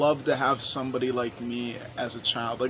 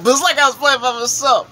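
loud, high-pitched protest; exasperated insistence; 9 semitones higher, 8 dB louder than usual. Retold or imitated. Player insisting the teammate was no help, high exasperated protest. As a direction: drive the complaint home, pitched up and loud. Repeats the complaint for emphasis.

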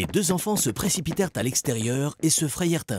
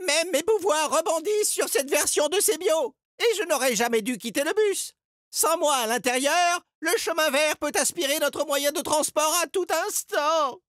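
high-pitched distressed complaint; lamenting. A narrator's reading. Edgar regrets leaving the bus, high and distressed. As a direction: bemoan the disaster in a high voice. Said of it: "se lamenta Edgar".

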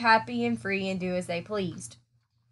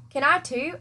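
put-on child asking; 6 semitones higher than usual. A narrator's reading. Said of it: "asked Avery".

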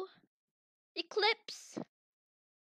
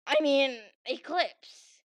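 usual speaking voice

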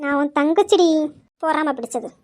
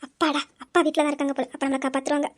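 softer, lower relieved murmur to herself; relieved aside; 4 semitones lower, 7 dB quieter than usual. Acted. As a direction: breathe out relief under the breath. (தனக்குள் மெதுவாக நிம்மதியுடன் முணுமுணுப்பு)

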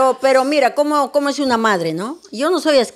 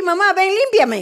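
slow, higher whiny plea, mocking; mock whiny daughter; 5 semitones higher than usual. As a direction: whine like a spoiled child. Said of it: "me va a decir".

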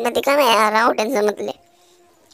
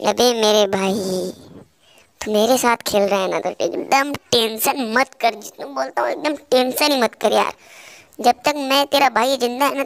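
ordinary talk, nothing marked

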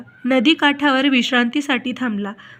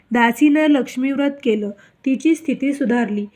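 plain reading voice

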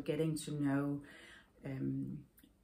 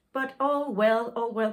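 louder, higher, cheerful reassurance; reassuring; 7 semitones higher, 10 dB louder than usual. Real, not acted. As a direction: brush the worry away brightly. Reassuring herself after worry.

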